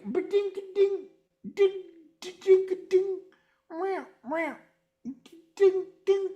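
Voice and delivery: very high ding-ding imitation of slide guitar; ping it out like a cheap slide; mocking slide guitar noises, with a meow. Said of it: "And he's like".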